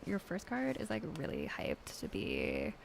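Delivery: lightly voiced Valley Girl accent with like and uptalk; a hint of a bored Valley Girl accent. Mean Valley Girl accent for a tarot reading. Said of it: "I need to do Valley Girl accent".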